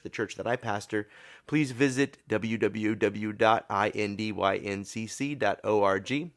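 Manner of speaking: ordinary talk, nothing marked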